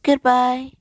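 put-on high girl, cold goodbye. Ita pushes him off the balcony, high girl voice.

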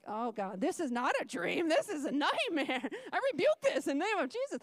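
hammed-up dismay breaking into laughter; laughing mock horror. Mother's mock horror at dream.

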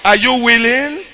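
loud questioning call; rousing question. Real, not acted. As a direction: challenge the crowd. Preacher asks the crowd.

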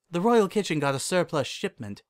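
lifted regal character voice; Celestia, composed princess. Acted.

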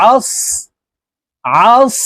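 "আস" begun with a pressed mid-throat ain; throaty ain. Shown as a demonstration. Teacher showing ain's articulation point, pressed throat. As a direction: squeeze the middle of the throat to start "আস". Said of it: "আইন হরফকে হলকের মধ্যখান থেকে চাপ দিয়ে".

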